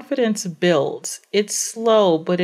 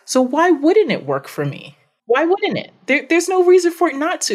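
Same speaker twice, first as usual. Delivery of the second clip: rising high and insistent; fired-up rhetorical questions; emphatic; 9 semitones higher than usual. Real, not acted.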